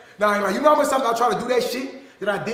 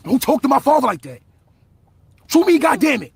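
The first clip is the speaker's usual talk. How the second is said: loud, raised-pitch telling-off; scolding shout; 5 semitones higher, 12 dB louder than usual. Real, not acted. Scolding young lady for talking back to father, loud indignant shout. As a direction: shout them down in defence. Defends father from child's backtalk.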